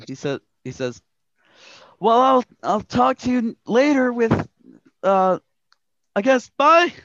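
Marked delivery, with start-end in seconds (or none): 2.0-6.9 much higher, louder awkward goodbye; a kid trailing off shyly; boy detective. Acted. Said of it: "Angus McDonald, boy detective".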